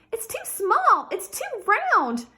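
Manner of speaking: put-on a small girl refusing her gift; princess voice. A narrator's reading. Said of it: "said Princess Pinecone".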